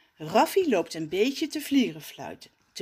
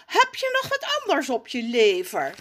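louder impatient ask; impatient question; 6 semitones higher, 5 dB louder than usual. Acted. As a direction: hurry the dawdler along. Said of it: "vraagt hij ongeduldig".

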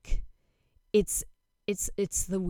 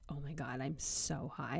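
hushed, low confession of being high; giggly-high whisper; 3 semitones lower, 8 dB quieter than usual. Retold or imitated.

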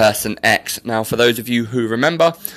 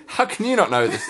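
ordinary delivery

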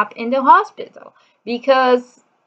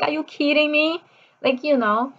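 ordinary delivery

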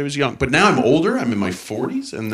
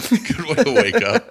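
laughing echo; 12 semitones higher than usual. Acted.

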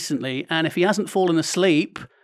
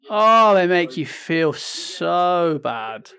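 drawn-out; much slower than usual. Real, not acted. Drawn-out sympathy about tipping.

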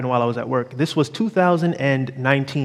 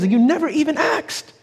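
higher rising accusation; mock accusing peak; 10 semitones higher than usual. Sister confronting the EMT, rising angry reproach. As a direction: throw the accusation back hard. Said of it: "she says".

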